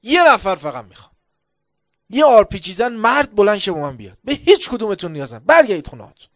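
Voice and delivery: defiant battlefield shout; commander's shout. Retold or imitated. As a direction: bark orders at the troops.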